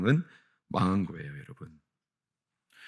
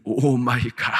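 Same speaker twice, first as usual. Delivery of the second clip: hammed-up wretched "oh my God"; mock misery; 2 semitones higher, 5 dB louder, slower than usual. Shown as a demonstration. Preacher showing the other meaning to congregation, mock misery. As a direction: groan it loudly as a mock lament. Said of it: "비참하다는 뜻이에요".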